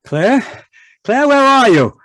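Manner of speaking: loud, high call across the yard. Acted. Husband calling for his wife outside. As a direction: shout for her from outside.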